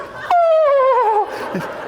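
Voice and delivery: very high, loud "oh" imitating a shocked woman; mock embarrassed shriek. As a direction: squeal as if suddenly mortified. Woman realizes what she prayed.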